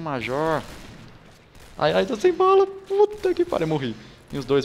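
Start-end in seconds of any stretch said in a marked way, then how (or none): 1.8-4.0 shrieking high and loud about no ammo; panicked squeal. Real, not acted. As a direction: yelp in real panic, pitch way up. (grito agudo de pânico, bem mais alto)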